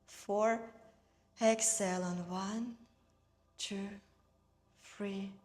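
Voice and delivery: unhurried meditation guide, evenly paced; guided meditation. Real, not acted. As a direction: guide them gently through each breath, leaving space. Leads breathing and guided meditation.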